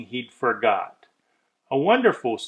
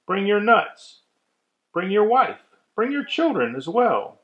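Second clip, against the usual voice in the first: lightly voiced cheerful inviter; 8 semitones higher than usual. A creature inviting guests aboard.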